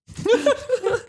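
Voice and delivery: high squeaky laugh. Real, not acted. Warming up for [name].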